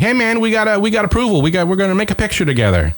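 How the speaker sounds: high-pitched retold phone chatter; excited comedian pitching down the phone, high; Bobcat Goldthwait. Comedian on the phone in the green room, high excited voice. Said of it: "he's on the phone and he's like".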